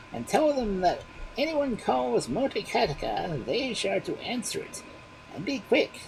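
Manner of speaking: the bones give orders; magic bones. A narrator's reading. Magic bones instructing the baby, high.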